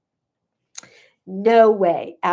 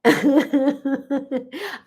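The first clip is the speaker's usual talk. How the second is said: bright rising laugh; real laugh; 4 semitones higher than usual. Real, not acted. Host laughing again at the tears-for-dinner joke. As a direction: laugh out before you speak.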